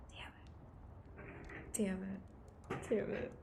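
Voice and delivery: whispered "Damn it" rising toward normal voice; mild frustration. Real, not acted. Itchy streamer cursing a mosquito bite, building from whisper. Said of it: "I'm frustrated".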